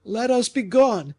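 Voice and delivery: very high, loud frantic urging; urgent cry. A narrator's reading. Fouquet urging Gourville to flee, frantic shout. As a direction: hurry him out desperately.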